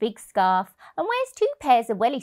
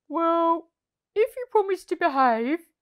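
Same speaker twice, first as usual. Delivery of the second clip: hesitant, higher Percy voice; cautious; 8 semitones higher, 4 dB louder than usual. A narrator's reading. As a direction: think it over aloud. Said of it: "he said".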